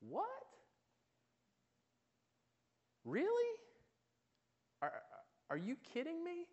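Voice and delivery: very high incredulous squeak; disbelief. Retold or imitated. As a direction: sound stunned, as if you can't believe it. World's incredulous response.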